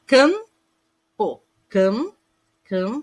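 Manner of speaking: campo split, its "cam" held with a nasal hum; nasal vowel demo. Shown as a demonstration. Teacher letting pupils hear the nasal sound, drawn out. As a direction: dwell on the nasal "am" in campo, then repeat cam. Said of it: "um som nasal".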